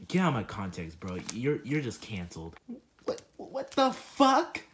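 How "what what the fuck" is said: high, slow, drawn-out shocked yell of disbelief; stunned outburst. Acted. Junior reacting to being canceled with a stunned shout. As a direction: let the disbelief stretch out loud.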